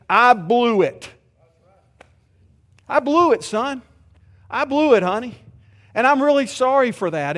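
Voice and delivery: a father admitting fault, loud and pleading; father's confession. Acted. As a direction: confess to the kids as a dad, with fervor.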